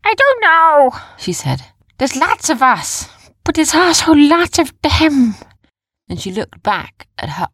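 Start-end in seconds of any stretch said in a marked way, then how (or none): 0.0-1.0 put-on high, loud young heroine; Jumba's character voice. A narrator's reading. Heroine admitting she has no plan, high, loud character voice. 2.0-5.4 put-on high, loud young heroine; Jumba's character voice. A narrator's reading. Heroine admitting she has no plan, high, loud character voice.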